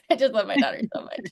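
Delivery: laughing through real tears, a little fast; choked-up laugh as the feeling wells up; tearful, laughing while moved; teary laugh. Real, not acted. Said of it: "so I'm, like, crying right now".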